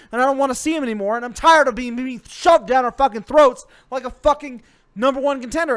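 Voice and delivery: loud, furious ranting; hammed-up furious tirade; angry rant. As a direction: rage at the wrestler, shouting. Angry rant about wrestler.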